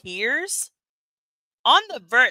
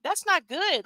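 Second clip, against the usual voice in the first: surprised; 9 semitones higher than usual. Retold or imitated. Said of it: "and I was like".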